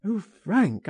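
high, pleading exclamation; anguished cry. A narrator's reading. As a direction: cry out to a friend in distress. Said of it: "he cried".

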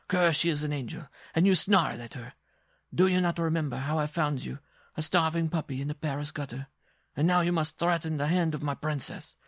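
high-pitched dwarf scolding his dog; indignant dwarf. Acted. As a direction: berate the dog with rising indignation.

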